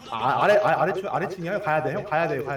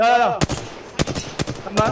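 grenade warning yell; 4 semitones higher, 4 dB louder than usual. Real, not acted. Enemy spotted, grenade fight.

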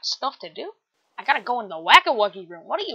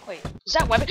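ordinary talk

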